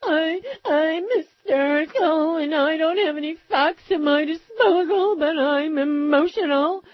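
high, slow, overwrought whimpering pundit; mock hysterical Glenn Beck. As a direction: play him as a weepy, hysterical fearmonger.

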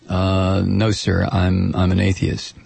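low, subdued reply to the officer; questioned passenger. Acted. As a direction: answer meekly in a low monotone.

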